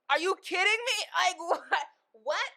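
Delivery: high, loud and laughing; can't believe it, laugh-shout; outraged disbelief. Real, not acted. Imagining a thrown wedding, loud laughing disbelief.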